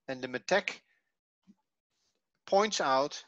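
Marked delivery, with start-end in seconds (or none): none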